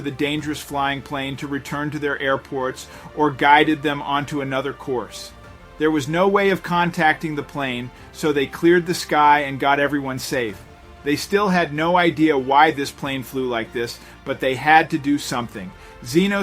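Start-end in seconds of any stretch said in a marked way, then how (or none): none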